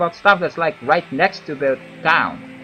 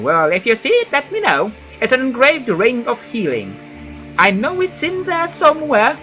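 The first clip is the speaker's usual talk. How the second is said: higher whiny villager voice; Fargoth; 9 semitones higher, 4 dB louder than usual. Acted. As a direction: voice a fussy whiny elf. NPC Fargoth dialogue voiced.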